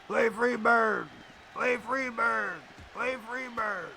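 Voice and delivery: mocking rowdy fans calling for a song; imitating hecklers. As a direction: drag out each word like a bored crowd heckler. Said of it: "these guys are yelling".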